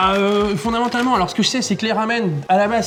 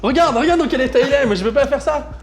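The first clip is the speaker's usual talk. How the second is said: excited; 8 semitones higher, 4 dB louder than usual. Real, not acted. Horrified at friend's state.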